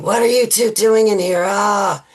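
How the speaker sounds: loud, high mock scream of outrage; retold screaming. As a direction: imitate someone screaming at a couple they caught.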